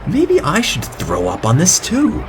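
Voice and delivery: high, slow mocking voice for a dim guest; mock guest's thought. Mocking the guest who throws up on barf, high and slow. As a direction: ham up the silly guest's reasoning.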